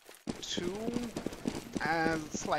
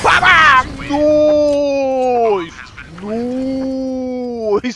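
excited shout; 6 semitones higher, 16 dB louder, much slower than usual. Real, not acted. Caster reacting to a kill.

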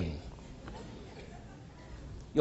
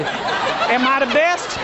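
mock wife's voice, drawn out; playful slow wife question; wife's line; 4 semitones higher, much slower than usual. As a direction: act out the wife asking, slowly and teasing. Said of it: "Woman, you better say".

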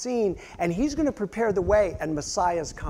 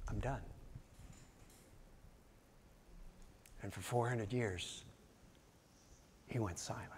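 lower, much softer, final; hushed and final; 8 semitones lower, 22 dB quieter than usual. Acted. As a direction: let it fall away quietly. God's final word, hushed.

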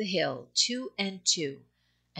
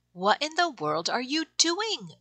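high, curious puppy voice; 8 semitones higher than usual. Acted. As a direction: ask like a curious little pup. Puppies ask the poky puppy.

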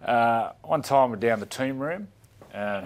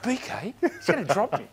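mock worried player; 10 semitones higher than usual. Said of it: "Betsy's like".

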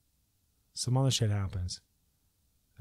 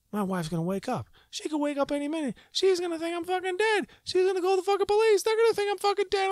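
much higher, louder, faster frantic rant; rising panic; 5 dB louder, faster than usual. Retold or imitated. Fearing his wife calls police, a frantic high rant. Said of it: "I'm like".

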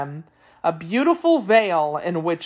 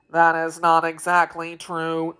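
robotic monotone; mechanical machine man. A narrator's reading. Tik-Tok correcting the Wheeler, robotic monotone. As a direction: say it all on one note like an automaton.

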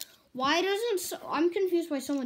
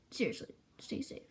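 whisper; 9 dB quieter than usual. Acted. Whispered repeat of advice.

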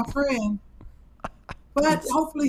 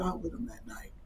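whisper; 6 semitones lower, 14 dB quieter than usual. Real, not acted. Confessing a grim secret.

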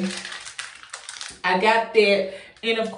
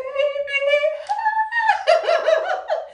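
shrieking gleeful laugh; a squealing happy laugh; high delighted laugh. Real, not acted. Delighted laugh at her shower.

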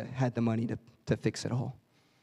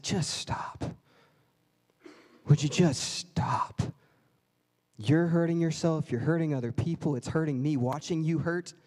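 God tenderly pleading with a broken believer; tender plea. Acted. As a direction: gently beg them to stop hurting. God voiced pleading gently.

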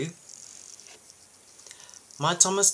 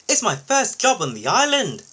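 high-pitched, eager call; lifted voice answering a friend; calling back; 8 semitones higher, 3 dB louder than usual. A narrator's reading. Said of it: "Jack called back".

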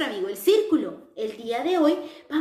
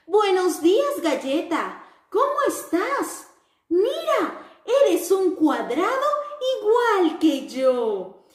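high, bright-eyed little square voice; sing-song greeting for children; cheerful square character; 4 semitones higher than usual. Acted. The square happily greets its friends in a high cheerful voice.

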